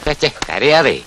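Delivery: put-on soft, soothing hush to a child; calm the crying boy softly; teacher hushing a crying boy, softly; gentle hush.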